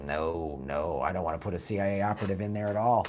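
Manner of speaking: ordinary delivery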